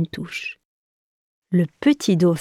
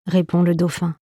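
plain reading voice

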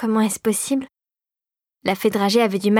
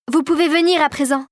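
calling out; 6 semitones higher than usual. Acted. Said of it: "les apostropha Marie".

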